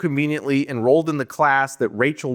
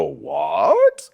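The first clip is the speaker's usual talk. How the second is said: low, drawn-out puzzled what; mock stunned viewer; a dropped, baffled what; viewer's shock; 12 semitones lower than usual. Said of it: "we're supposed to go".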